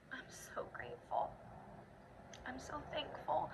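choked, hushed near-whisper through tears; fighting back tears, barely getting words out; tearing up. Real, not acted. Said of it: "I'm tearing up a little bit".

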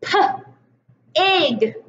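p-ig split onset and rime; segmented sounds. Shown as a demonstration. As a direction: sound out p then ig. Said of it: "what about".

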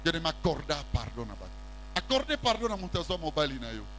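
usual speaking voice

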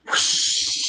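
voiceless rushing whoosh; flying-broom sound effect. Acted. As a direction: blow a long rush of air.

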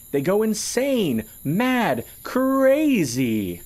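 slow, giddy, unhinged crescendo of delight; gleeful shriek. A narrator's reading. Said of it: "Piker danced gleefully".

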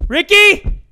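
loud, high searching holler; calling out. Real, not acted. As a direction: call the name across the ship. Calling out for game character.

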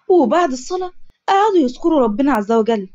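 usual speaking voice

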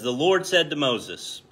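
scripture reading. Acted. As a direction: proclaim the verses like a preacher. Reads scripture verses aloud.

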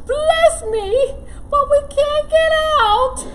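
high, loud sniffling wail; sniffly loris. Acted. Loris with allergies sniffling in the hole. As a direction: sniffle through the lament. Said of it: "Sniffled Loris".

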